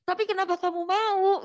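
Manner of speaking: high-pitched imitated challenge; retold incredulous question. Questioning her about agreeing to marry, retold incredulity. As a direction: ask it high, as a playful interrogation.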